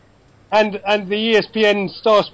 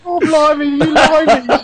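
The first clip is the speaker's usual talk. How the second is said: higher, louder laughing Cockney put-on; mock English accent; 6 semitones higher, 4 dB louder, slower than usual. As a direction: ham up a Cockney exclamation through laughter. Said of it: "You can't do an English accent".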